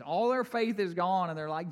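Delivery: normal talking voice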